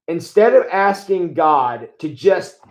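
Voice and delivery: ordinary delivery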